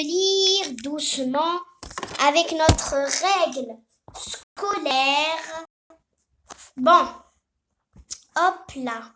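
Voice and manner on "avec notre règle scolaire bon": ordinary talk, nothing marked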